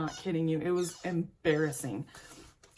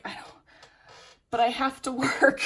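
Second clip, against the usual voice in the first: tearful protest; 7 semitones higher, 7 dB louder than usual. Retold or imitated. Pleading with doctor, voice breaking.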